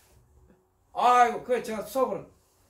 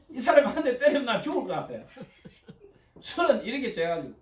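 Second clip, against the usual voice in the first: usual speaking voice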